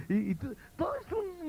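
mock frenzied crying. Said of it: "un gritadero".

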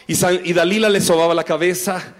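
ordinary talk, nothing marked

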